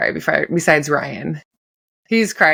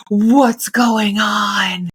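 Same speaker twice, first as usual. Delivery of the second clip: slow, drawn-out concerned question; a lightly voiced worried husband; mock worried husband; 4 semitones higher, much slower than usual. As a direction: imitate him asking gently, slowed right down. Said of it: "he was like".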